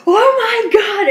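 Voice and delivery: loud, high, drawn-out thrilled exclamation; excited outburst. Retold or imitated. Storyteller running in to friends at work with news, excited outburst. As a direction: burst out, reliving huge excitement. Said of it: "I'm so excited".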